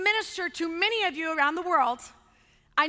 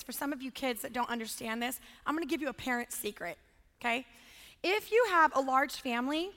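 usual speaking voice

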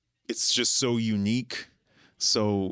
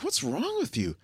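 exasperated friend; 12 semitones higher than usual. Retold or imitated. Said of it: "I'm like".

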